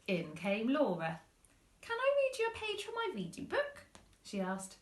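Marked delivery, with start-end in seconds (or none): 1.8-3.8 put-on high, quick child voice; eager little girl. Laura asking her mum, high eager girl. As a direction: ask brightly like a small girl.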